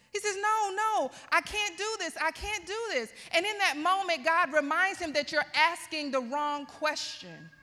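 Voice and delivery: high, driving sermon delivery; impassioned preaching. Acted. Preacher voicing God's answer to Moses, impassioned and high.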